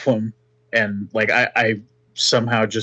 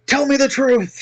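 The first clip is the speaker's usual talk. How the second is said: high, raised interrogator's shout; heated, high-pitched order; forceful demand; 4 dB louder than usual. Acted. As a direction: demand it mid-punch. Punches captive while interrogating.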